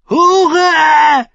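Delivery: high, loud hate-filled screech; shrieking. A narrator's reading. Grieving mother to the queen, shrieking in hate. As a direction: shriek the insult in raw hatred. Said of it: "kreischte sie".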